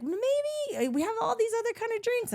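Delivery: high, fast condescending waiter voice; mock a snooty waiter steering her away; pushy waiter. Waiter talks her out of a drink, high fast snooty voice.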